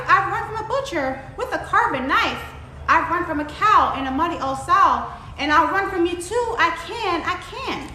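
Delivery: high, sing-song boast with stretched words; taunting gingerbread man. A narrator's reading. Gingerbread man brags to the fox, taunting. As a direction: brag playfully in a lilting chant. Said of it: "put his hands on his hips and said".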